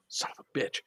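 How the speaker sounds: whispered, hushed swear; whispered curse. Retold or imitated. Hiding prone as the Sasquatch of a man approaches, a whispered curse. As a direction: breathe the curse so no one hears.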